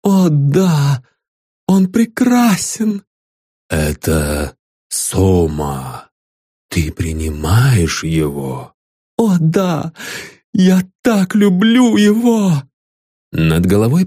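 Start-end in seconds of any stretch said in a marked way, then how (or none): 0.0-3.0 ecstatic. Acted. Ecstatic woman answers the messenger. 3.7-8.8 solemn messenger. Acted. The messenger questions her. 9.2-12.6 ecstatic. Acted. Ecstatic woman answers the messenger.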